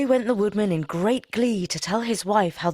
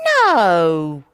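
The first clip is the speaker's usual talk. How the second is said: stern wife; 3 semitones higher, 7 dB louder, slower than usual. Acted. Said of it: "his wife said".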